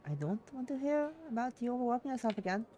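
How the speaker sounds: high-pitched remembered line; echoing mother's words. Acted. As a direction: replay mum's warning as a memory. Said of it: "echoes in my head along the way".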